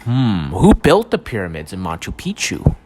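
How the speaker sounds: mystery documentary narrator. Acted. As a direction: tease the audience like a late-night mystery show host.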